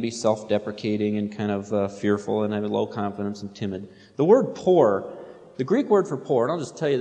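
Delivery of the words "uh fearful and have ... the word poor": ordinary talk, nothing marked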